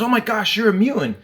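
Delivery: high, startled cry of disbelief; shocked exclamation. Retold or imitated. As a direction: pitch it up in shock. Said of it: "he goes".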